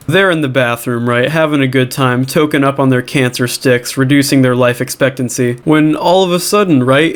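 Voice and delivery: usual speaking voice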